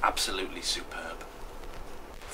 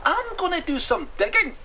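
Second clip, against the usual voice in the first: very high, loud announcement; high-pitched, eager figure; keen character voice; 7 dB louder than usual. Acted. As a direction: declare the plan in a squeal. Hamish announces his plan.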